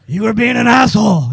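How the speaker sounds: lightly gruff hero scolding; gruff Batman. Pretend. Batman scolding the crowd blocker, a hint of gruff. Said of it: "He could just be like".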